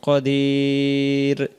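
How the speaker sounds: কাদির with the long আ held and a closed র; stopping on the word. Shown as a demonstration. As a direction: stretch কাদির very slowly, then stop. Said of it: "যদি আমরা এখানে দম ছেড়ে দেই". (থামার সময় কাদির, আ লম্বা টেনে)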